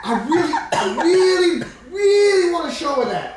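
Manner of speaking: high, loud eager brag; lusty boast. Acted. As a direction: push it out, overexcited. Targaris boasts lustfully.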